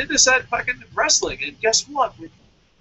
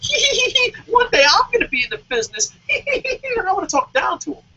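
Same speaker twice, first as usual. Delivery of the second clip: high, loud giggling wannabe voice; mocking giggly fan; 12 semitones higher, 5 dB louder than usual. Internet fan dreaming of the business, high mocking giggle. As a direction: giggle it out like a smug would-be insider. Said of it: "internet fans that are like".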